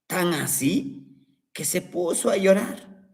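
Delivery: usual speaking voice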